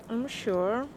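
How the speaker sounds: usual speaking voice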